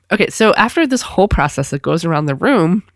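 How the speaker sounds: ordinary talk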